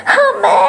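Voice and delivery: very high startled yelp, loud; sudden scream. A narrator's reading. Girl yelping as something crashes over, a sudden high shriek. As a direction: cry out as you trip and fall. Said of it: "അവളുടെ നിലവിളിയും".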